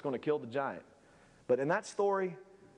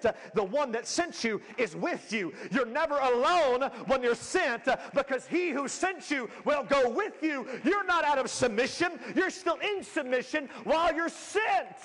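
building, louder preacher climax; preaching; 10 semitones higher, 3 dB louder than usual. Acted. Pastor assuring the church, preaching louder and louder. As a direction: drive it home to the pews.

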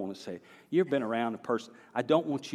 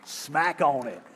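"Smack on it" with a broad Southern accent, punched and louder; Southern drawl; 4 semitones higher, 6 dB louder, slower than usual. Shown as a demonstration. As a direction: lean into the Southern twang on "Smack on it". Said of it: "I know that's very Southern".

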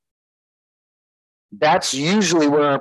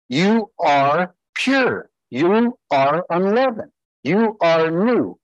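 slow solemn proclamation; 4 semitones higher, slower than usual. Acted. Proclaiming Paul's words slowly.

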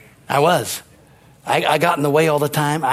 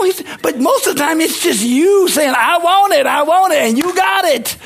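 preaching at full force; 11 semitones higher than usual. Acted. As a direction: rouse the congregation, building energy.

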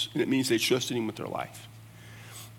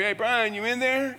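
higher-pitched call to a colleague; calling through door; 9 semitones higher than usual. Retold or imitated. Acting out calling through office door.

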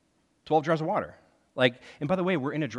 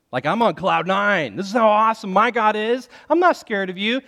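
loud, higher, boastful and fearless; cocky triumph; 7 semitones higher, 10 dB louder than usual. Retold or imitated. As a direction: crow it, on cloud nine. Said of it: "you would think Elijah would be like".

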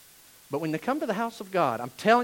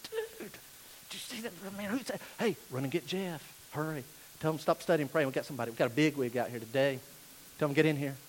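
sharply softer, hurried eager whisper-talk; hushed excited usher; 6 semitones lower, 10 dB quieter, faster than usual. Retold or imitated. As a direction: nudge a friend about the rich guest, quietly. Usher excited about rich visitor.

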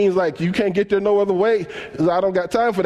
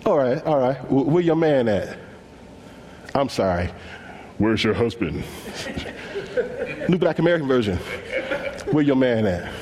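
low street Jesus; 7 semitones lower, 4 dB quieter than usual. Pretend. Jesus asking the woman about her man, low and casual. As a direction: lower casual question. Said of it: "He says".

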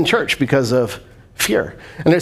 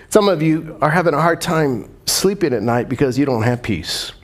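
ordinary delivery

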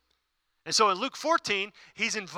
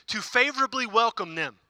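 usual speaking voice

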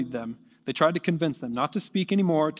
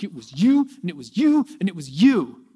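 pointing accusation; 2 semitones higher, 6 dB louder than usual. Acted. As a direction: point the finger at each one in turn. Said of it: "And he's like".

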